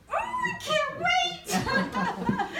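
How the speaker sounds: high, slow, stretched-out thrilled squeal; giddy excitement. Retold or imitated. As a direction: gush like you can't contain it. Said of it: "and I was like".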